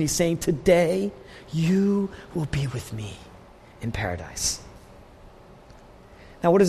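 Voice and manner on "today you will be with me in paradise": deeply moved. Retold or imitated. To the dying thief, hushed and tender. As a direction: let it fall away, tender and broken. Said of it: "as he's moved by this person".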